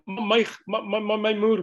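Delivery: ordinary talk, nothing marked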